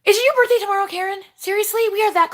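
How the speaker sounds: high, loud, delighted; squealing with surprise; excited surprise. Real, not acted. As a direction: gasp with delight at the news. Excited reading a viewer comment.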